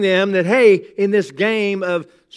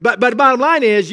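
normal talking voice

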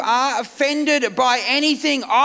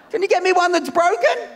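high pleading; 6 semitones higher than usual. Retold or imitated. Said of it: "She said".

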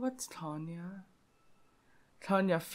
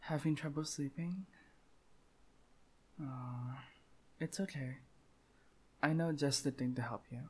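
hushed and intimate, coaxing the listener to sleep; softly, like tucking someone in; whisper-soft girlfriend roleplay; soft bedtime voice; 3 semitones lower, 10 dB quieter than usual. Acted.

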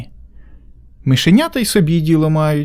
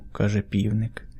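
usual speaking voice

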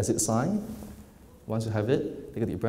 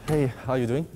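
normal talking voice

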